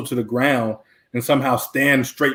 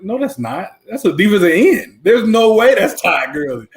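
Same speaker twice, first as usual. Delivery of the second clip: higher-pitched mock incredulity, building louder; retold disbelief; 6 semitones higher, 4 dB louder than usual. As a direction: recall flatly refusing to believe it, pitch raised. Retelling his disbelief at a freshman.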